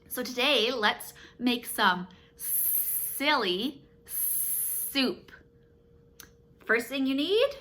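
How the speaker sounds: put-on bright, sing-song talk to a child; playful kids voice. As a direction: make it a fun game for kids.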